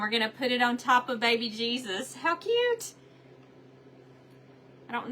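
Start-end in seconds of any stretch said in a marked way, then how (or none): 2.2-2.9 high delighted squeal; excited squeal. Real, not acted. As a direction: squeal with delight over something tiny. Crafter squeals over tiny crown.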